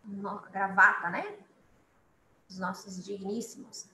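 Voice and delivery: ordinary talk, nothing marked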